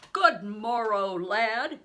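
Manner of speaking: slow, loud call of greeting; booming slow greeting to a stranger boy; cried out. A narrator's reading. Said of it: "he cried out".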